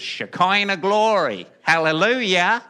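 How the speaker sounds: put-on Southern accent, big and showy; southern preacher accent. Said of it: "I can do a southern accent".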